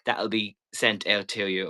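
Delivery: normal talking voice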